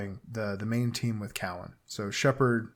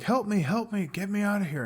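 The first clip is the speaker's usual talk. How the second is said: high, pleading cries for help; trapped man in cocoon begging, high pleading; pleading captive; 8 semitones higher than usual. Retold or imitated. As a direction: beg to be freed, voice pitched way up. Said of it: "the guy's like".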